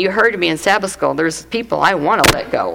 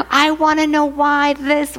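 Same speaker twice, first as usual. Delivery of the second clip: put-on high kid voice asking why; lightly voiced child; childish whine; 8 semitones higher than usual. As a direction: play the nagging little girl.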